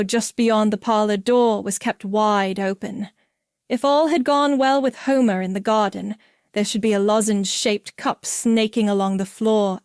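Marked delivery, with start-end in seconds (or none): none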